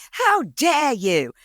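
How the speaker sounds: high, drawn-out Australian outrage; mock Australian accent. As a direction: imitate an indignant Australian girlfriend, stretching the words. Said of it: "I'm not gonna do the accent".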